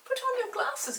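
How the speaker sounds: jumps up high and loud in sudden realisation; startled high reminder; sudden high exclamation. Real, not acted. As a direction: burst out as if just remembering.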